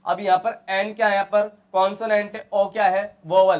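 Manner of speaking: normal talking voice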